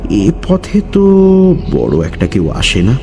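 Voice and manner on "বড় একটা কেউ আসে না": ordinary delivery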